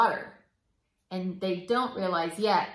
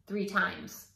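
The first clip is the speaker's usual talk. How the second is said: plain reading voice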